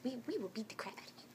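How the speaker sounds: playful menacing whisper; hushed, hurried pretend threat. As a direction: whisper a mock warning, quickly. Mock threat as protective mother.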